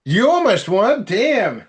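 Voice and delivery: high, loud amazed cheer; excited. Real, not acted. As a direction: shout it out impressed. Reacting to second place news.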